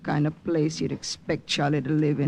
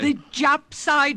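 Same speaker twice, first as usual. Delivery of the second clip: scornful question; 8 semitones higher, slower than usual. Acted. Scornful retort to brother.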